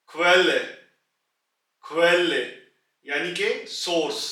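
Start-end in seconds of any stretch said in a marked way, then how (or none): 0.1-0.5 क्वेल said loudly with a guttural खू at the start; model word. Shown as a demonstration. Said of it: "खू से". 1.8-2.3 क्वेल said loudly with a guttural खू at the start; model word. Shown as a demonstration. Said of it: "खू से".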